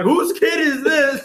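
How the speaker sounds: very high, drawn-out wail; mock bus driver. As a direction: squeal the demand in mockery. Friend mimics the driver again.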